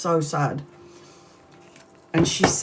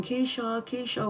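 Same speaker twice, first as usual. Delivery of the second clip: put-on small child calling softly, higher; tiny little voice; 7 semitones higher, 8 dB quieter than usual. Little brother softly calling his sister, tiny voice. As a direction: call her name like a tiny boy far off. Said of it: "with a tiny little voice".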